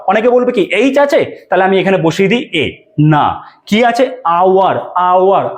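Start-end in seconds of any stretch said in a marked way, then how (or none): 4.2-5.6 আওয়ার said as "aoar" with silent h; teacher models আওয়ার slowly, no h sound; pronounced hour. Shown as a demonstration. English teacher showing আওয়ার with the h dropped. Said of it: "আয়ের মতো উচ্চারণ হচ্ছে".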